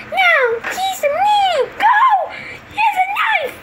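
very high-pitched scream; acted panic. Kids fleeing a knife, high acted panic. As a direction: shriek a warning to run.

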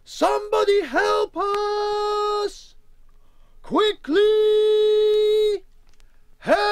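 high, loud, long-drawn hollering; top of the voice. A narrator's reading. Wally summons rescuers for Emma, top of the voice. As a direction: holler slowly so it carries far. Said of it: "at the top of his voice he boomed".

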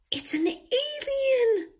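high, slow, eager whisper; excited whisper. A narrator's reading. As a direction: breathe it out thrilled. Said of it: "whispered excitedly".

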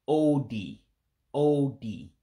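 O D with a round oh and a sharp ee; Igbo pronunciation. Shown as a demonstration. Explaining the dotted letters to learners, careful pronunciation. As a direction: say O D slowly, oh-dee, both vowels pure. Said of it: "really sharp high like I or E sound".

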